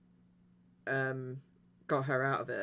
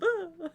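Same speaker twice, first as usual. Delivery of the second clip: high startled mock shriek; mock scream; 4 dB louder than usual. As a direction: squeal like she's hugging her teddy. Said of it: "and she's like".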